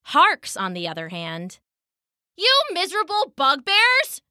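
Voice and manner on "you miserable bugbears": high-pitched, furious scream; screaming in fury. A narrator's reading. Girl screams at the fleeing villains, furious shriek. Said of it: "she screamed".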